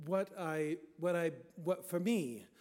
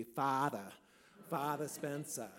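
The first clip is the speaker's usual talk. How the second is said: dropped-R Rhode Island pronunciation; Rhode Island accent. Shown as a demonstration. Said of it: "say it with a Rhode Island accent".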